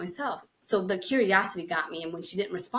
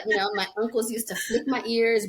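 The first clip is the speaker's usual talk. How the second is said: usual speaking voice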